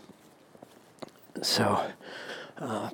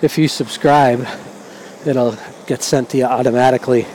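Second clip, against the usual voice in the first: raised voice; 3 semitones higher, 5 dB louder than usual. Real, not acted. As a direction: speak up to be heard over wind and traffic. Talking over traffic and wind.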